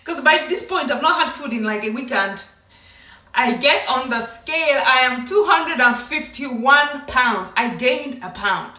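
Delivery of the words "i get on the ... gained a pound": slow, drawn-out, emphatic announcement; weighty, incredulous deliberation; dramatic slow reveal. Retold or imitated. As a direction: spell out the bad news word by word.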